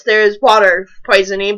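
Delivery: normal talking voice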